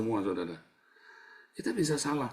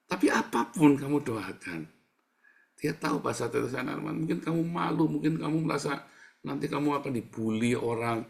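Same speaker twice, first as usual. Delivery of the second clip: normal talking voice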